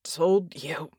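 hoarse, halting low voice; cracked, effortful voice; weak croak. A narrator's reading. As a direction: croak it out as if barely revived. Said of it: "Red croaked".

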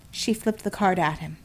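ordinary delivery

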